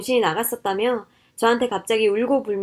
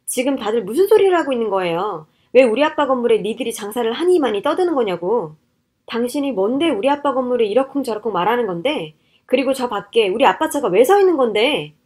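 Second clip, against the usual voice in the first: louder, indignant wife; angry confrontation; 5 dB louder than usual. Acted. Wife confronts her scheming in-laws over her father's building, angry. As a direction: demand answers in angry outrage.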